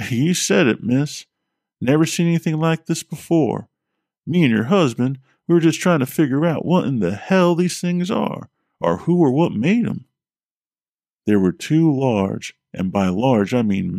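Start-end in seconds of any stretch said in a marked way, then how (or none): 0.1-10.1 put-on Vermont accent. A narrator's reading. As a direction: play the Vermont plumber, higher and easygoing. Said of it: "said the plumber in a Vermont accent".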